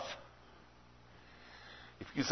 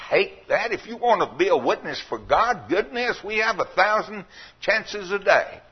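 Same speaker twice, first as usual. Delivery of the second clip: higher, fervent preaching voice; raised preaching; 7 semitones higher than usual. Acted. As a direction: press the point on the congregation.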